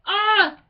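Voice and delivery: loud, high squeal of delight; real fangirl squeal; excited squeal. Real, not acted. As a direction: let out a happy fan shriek. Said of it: "Please excuse me for not making any sense".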